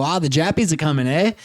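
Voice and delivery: casual mock-Australian lilt; Australian accent. Shown as a demonstration. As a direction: imitate an Aussie tossing out slang. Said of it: "they go".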